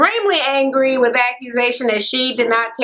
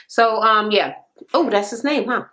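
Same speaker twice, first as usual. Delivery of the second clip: ordinary talk, nothing marked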